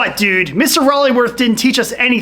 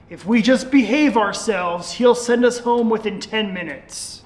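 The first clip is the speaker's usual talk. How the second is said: ordinary talk, nothing marked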